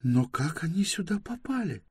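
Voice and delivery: high-pitched woman's calm question. A narrator's reading. Bayta questioning the men about the ship, calm. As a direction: ask evenly in a high female voice. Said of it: "спокойно спросила Байта".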